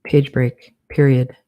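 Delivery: slow dictation, punctuation spoken aloud; dictating. Shown as a demonstration. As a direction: dictate it word by word with pauses.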